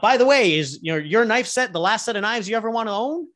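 spring the sale on him, loud and bright; salesman pitch. Acted.

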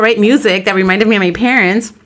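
ordinary talk, nothing marked

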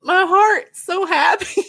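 slow, high, laughing through the words; laughing happily. Real, not acted. Host laughing as she gushes over the Irish kindness. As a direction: giggle it out, overjoyed. Gushing over the news story.

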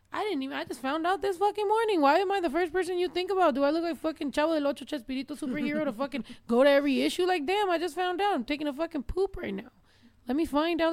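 high, loud exasperated rant. Retold or imitated. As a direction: vent it, fed up and incredulous. Venting reply to online critics.

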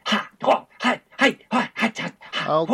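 short sharp martial arts yells; hammed-up kung fu kiai cries; kung fu shouts. Monkey throws fists like a kung fu movie, yelling. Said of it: "throwing out his fists ... like a kung fu movie".

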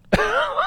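a quick high real laugh. Real, not acted. Host teasing her accent, quick high laugh. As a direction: laugh at your own joke.

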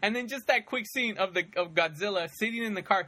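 ordinary delivery

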